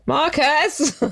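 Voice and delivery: high call to the next room. Real, not acted. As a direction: call his name across the house. Calling to him in another room.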